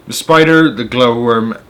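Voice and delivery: usual speaking voice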